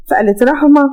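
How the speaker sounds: usual speaking voice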